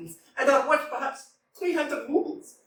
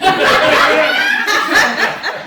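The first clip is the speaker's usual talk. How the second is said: long, loud burst of laughter; real laugh; 9 dB louder than usual. Real, not acted. Actress cracking up after her line, a loud long laugh.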